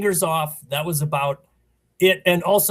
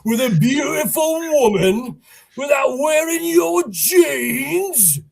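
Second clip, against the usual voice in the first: higher, louder, slow mocking taunt; mocking Wes; 6 semitones higher, 6 dB louder than usual. Wes making fun of two teenagers, higher, louder, slowly mocking. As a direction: jeer at the teenagers, drawing it out. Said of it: "he's making fun of the two teenagers".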